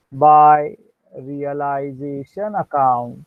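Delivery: slow, deliberate dictation so students can write; dictation pace. Shown as a demonstration. Teacher dictating the entry to students, slow and deliberate. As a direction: spell out each entry word by word.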